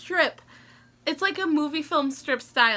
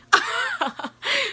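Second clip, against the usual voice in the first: burst of laughter; 13 semitones higher than usual. Real, not acted. Laughing about her fake-tough dog.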